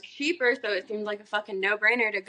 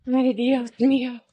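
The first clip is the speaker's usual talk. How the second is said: put-on Spanish flourish; Spanish exclamation; 4 dB louder than usual. Playing it up for the co-host over having a house, Spanish exclamation. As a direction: exclaim it with a playful Spanish accent. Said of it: "She's Spanish".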